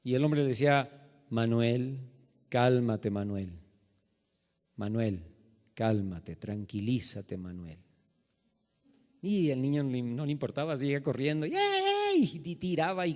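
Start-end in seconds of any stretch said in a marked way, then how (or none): 1.3-7.8 low, hushed, measured restraint; self-calming patience. Retold or imitated. Man restrains himself in store story. 11.5-11.6 high-pitched playful "yay" squeal; mock child squeal. Preacher imitating a boy running round the store, mock squeal. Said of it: "gritando y haciendo berrinche".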